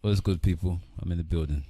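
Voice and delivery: low-pitched laid-back greeting; sounding like Vader. Real, not acted. Podcast guest introducing himself to the people, deep Vader-like voice. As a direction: greet everyone down in your lowest register. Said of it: "you sound like Vader".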